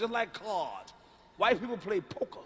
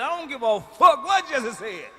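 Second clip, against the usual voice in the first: defiant outburst; 4 semitones higher, 6 dB louder, slower than usual. Acted. Defiant rant against marching orders.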